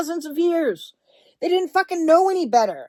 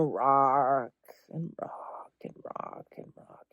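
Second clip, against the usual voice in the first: low, slow whisper, rocking rhythm; soft whisper; 12 semitones lower, 10 dB quieter, slower than usual. Shown as a demonstration. Whispering to a grieving listener how to rock. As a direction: lull them as if rocking.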